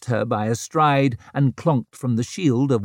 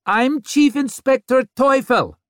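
loud, raised voice; calling out; 13 semitones higher, 5 dB louder than usual. A narrator's reading. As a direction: call it out over a crowd. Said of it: "called Vic".

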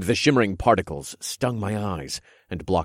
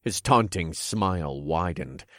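ordinary delivery

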